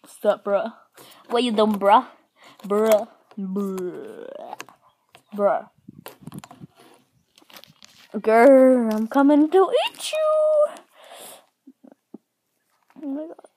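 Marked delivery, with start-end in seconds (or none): 0.0-5.7 dude voice. Pretend. Voicing toys greeting each other. 8.1-10.7 much louder, higher, slow mock monster; monster growl. Toy pretending to eat another toy, playful monster roar.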